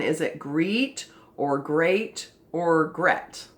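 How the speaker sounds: "greet", "great", "gret" slow; trying vowel sounds. Shown as a demonstration. Teacher sounds out "great" three ways. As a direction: test each vowel on "great". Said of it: "And the next one".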